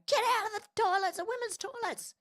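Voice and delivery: hammed-up angry Karen yelling get out; mock Karen scream. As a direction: shriek at a man in the women's toilets. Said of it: "I'll scream and go".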